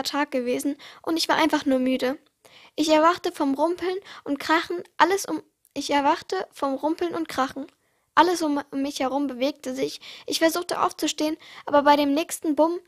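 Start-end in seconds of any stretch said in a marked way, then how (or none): none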